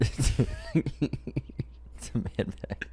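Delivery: slow breathy whisper. Real, not acted. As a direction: whisper it back slowly. Echoes the phrase back.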